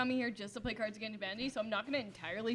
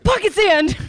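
Dale Gribble shout; 9 semitones higher, 19 dB louder, slower than usual. Acted. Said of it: "do Hank Hill".

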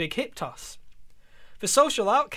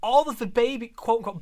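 usual speaking voice

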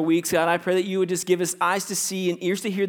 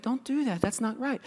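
soft, higher little angel voice; cartoon angel; 7 semitones higher, 7 dB quieter than usual. Pastor playing the shoulder angel scolding, soft mock-angel voice. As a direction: gently chide in a small, high voice. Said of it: "the angel on the other side".

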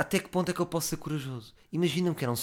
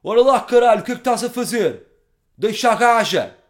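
much higher, much louder bellowing hard man; tough-guy voice; 11 semitones higher, 11 dB louder than usual. Retold or imitated. Confronting the drunk with a bottle, roaring hard man. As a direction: roar it like a street brawler. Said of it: "Salto da cadeira e faço voz".

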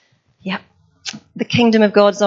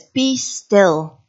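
stern. Retold or imitated. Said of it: "It's a stern voice".

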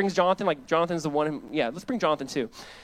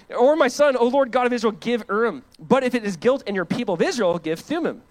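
raised, ringing preaching voice; loud scripture reading; 7 semitones higher, 6 dB louder than usual. Acted. Pastor reads Saul's story aloud to church, loud and ringing. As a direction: proclaim the verses to the congregation.

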